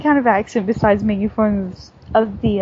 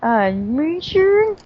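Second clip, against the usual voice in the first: hammed-up southern twang, higher; mock southern accent; 4 semitones higher than usual. Showing friend how they talk, mock southern accent. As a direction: stretch the last word in exaggerated Southern. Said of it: "That's how they talk".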